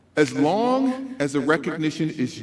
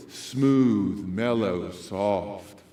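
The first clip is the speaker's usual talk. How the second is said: very low, soft, mellow; nighttime DJ voice; 10 semitones lower, 5 dB quieter, much slower than usual. Shown as a demonstration. Speaker sums up night DJ voice, smooth and soft. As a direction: sink into a smooth radio hush. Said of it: "That's our nighttime D J voice".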